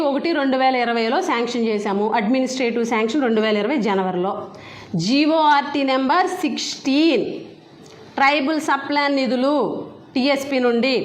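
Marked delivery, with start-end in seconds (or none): 5.1-7.2 very slow, spelling out each part; deliberate citation. Real, not acted. Minister citing the order number slowly for the press. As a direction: read the order number out for the record.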